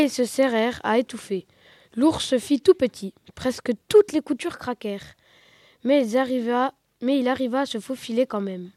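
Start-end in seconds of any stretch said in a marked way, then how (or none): none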